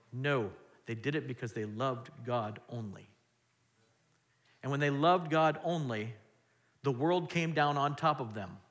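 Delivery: ordinary delivery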